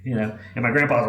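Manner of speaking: usual speaking voice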